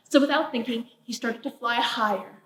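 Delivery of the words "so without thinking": usual speaking voice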